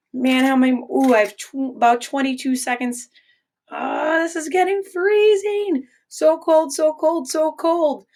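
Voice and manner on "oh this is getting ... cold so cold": high, squealing so cold; playfully freezing. Acted. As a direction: ham up being freezing cold. Acts out freezing hand, very high.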